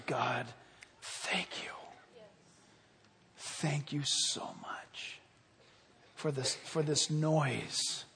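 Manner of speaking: slow, soft, low thanksgiving; intimate, breath-soft talk to God; hushed prayer. Shown as a demonstration. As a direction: pray almost in a whisper, drawing the words out.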